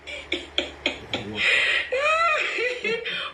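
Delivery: a long high laugh; burst of laughter. Real, not acted. Laughing at the story, a long high laugh.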